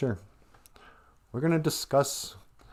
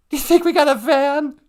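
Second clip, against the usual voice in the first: high, louder eager question; excited; 7 dB louder than usual. Real, not acted. To her husband about a fan, excited and high. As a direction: ask it thrilled.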